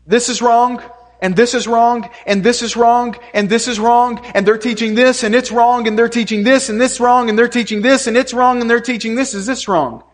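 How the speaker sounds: imitating relentless fault-finding in an insistent, steady singsong; mock repetitive denouncing. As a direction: pile up each accusation in the same repeated tune. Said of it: "basically our message becomes".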